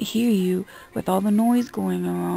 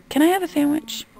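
high-pitched mimicry of a customer asking; mocking pleading request; 8 semitones higher than usual. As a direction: imitate a customer's raised question. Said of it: "they come up to us and go".

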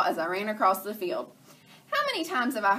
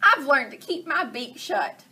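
hen voice; 7 semitones higher, 5 dB louder than usual. Acted. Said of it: "Chicken Little said".

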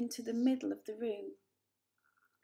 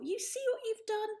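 much higher, reproachful; indignant mother; 13 semitones higher than usual. A narrator's reading. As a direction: blame him shrilly. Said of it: "She turned to the elder Poor".

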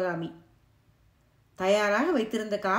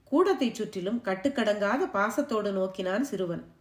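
ordinary delivery

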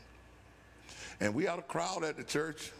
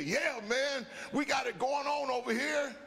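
joyful shout; 10 semitones higher than usual. Retold or imitated. Fellow airman answering the question, joyful shout. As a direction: call it out with joy. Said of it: "He said".

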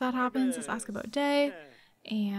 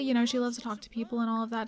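ordinary talk

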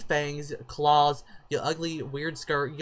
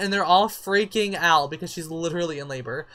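usual speaking voice

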